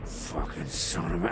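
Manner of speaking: low, quiet growl of a curse; muttered curse. Acted. Storyteller acts out a gunman cursing in the fog, muttered low. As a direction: curse low under your breath. Wounded shooter cursing in retold scene.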